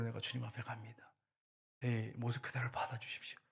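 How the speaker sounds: very soft, near-whispered prayer; hushed plea. Retold or imitated. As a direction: voice drops away into a humble, hushed offering. Voices the hymn writer's prayer.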